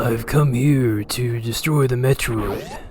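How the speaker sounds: Duke Nukem voice. Pretend. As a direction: deliver it like a tough action star. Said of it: "Samus Duke Nukem".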